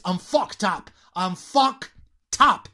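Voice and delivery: "fucked up" said as fuck-tup, then broken before the "tup"; linked T. Shown as a demonstration. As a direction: teach "fucked up" with T joined to "up". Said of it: "Lo unimos con la U de up". ("fucked up" con la T unida a "up")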